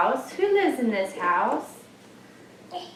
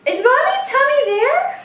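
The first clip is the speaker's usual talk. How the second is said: playful; 12 semitones higher, 4 dB louder than usual. Real, not acted. Playful question to toddler.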